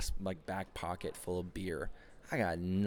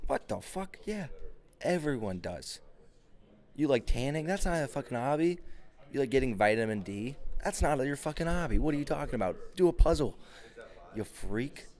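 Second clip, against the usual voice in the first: louder, higher comic rant; mock outrage; 6 semitones higher, 3 dB louder than usual. Comic ranting at a date about her hobbies, loud indignation. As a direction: rail at the lame answer.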